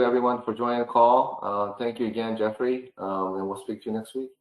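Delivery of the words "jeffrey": ordinary delivery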